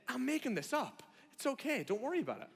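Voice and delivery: high, light, joking reassurance; comic don't-fret tone; playful reassurance. Acted. Imagined Jesus reassures the crowd.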